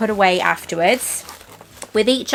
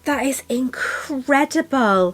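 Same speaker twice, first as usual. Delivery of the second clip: higher, slow gushing; real delighted gush; 5 semitones higher, slower than usual. Real, not acted. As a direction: coo over it. Admiring the ring.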